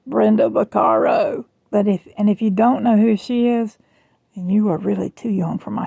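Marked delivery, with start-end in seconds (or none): 0.1-1.4 worst-voice imitation of a husky actress; Brenda Vaccaro. Shown as a demonstration. Vlogger mocking her own failing voice, bad celebrity impression. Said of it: "let me use my, my worst voice".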